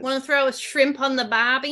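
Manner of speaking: mock-Aussie catchphrase; Australian accent. Shown as a demonstration. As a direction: toss out an Australian stock line. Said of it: "but Australian".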